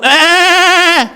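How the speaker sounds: long high-pitched bleat; imitated ram bleat. Ram stuck by its horns, high drawn-out bleat. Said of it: "un carnero atorado".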